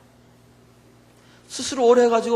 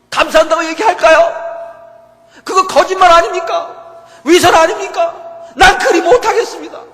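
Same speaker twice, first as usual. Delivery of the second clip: loud, higher, protesting prayer that rises into near shouting; arguing with God; 5 semitones higher, 8 dB louder than usual. Retold or imitated. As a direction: throw the complaint at God, getting louder each question. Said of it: "하나님께 따졌어요". (하나님께 따지듯 높고 크게 항의하며)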